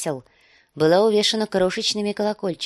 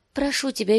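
desperate plea; 9 semitones higher than usual. Acted. Dany begs her brother.

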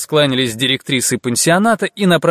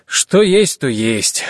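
охотно согласиться; 3 semitones higher, much slower than usual. A narrator's reading. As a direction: readily concede the point. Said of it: "охотно согласился Шабанеев". (охотно, с готовностью)

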